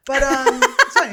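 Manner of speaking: high laugh through the words; laughing nervously about dad. Real, not acted. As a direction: giggle out a forced it's fine. Dad almost died, laughing it off.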